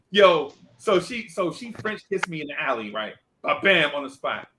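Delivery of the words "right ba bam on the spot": ordinary delivery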